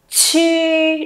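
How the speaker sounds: "Chi" with a puff of air, vowel held level; aspirated initial. Shown as a demonstration. Teacher reminds class to puff air, "Chi" aspirated. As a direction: breathe through the Ch of "Chi". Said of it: "bật hơi nào".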